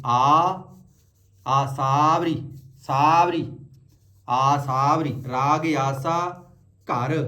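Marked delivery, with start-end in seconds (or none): 0.1-5.1 ਆਸਾਵਰੀ shown in parts, aa, then saavri. Shown as a demonstration. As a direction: teach ਆਸਾਵਰੀ piece by piece with long saa. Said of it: "ਆਸਾ ਅਲੱਗ ਨਹੀਂ ਕਰਨਾ".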